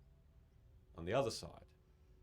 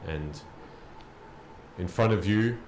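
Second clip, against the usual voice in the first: slow, loud, weighty storytelling; dramatic narration; 9 dB louder, slower than usual. Acted. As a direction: narrate the fall, slow and heavy. GM narrates the battle's end.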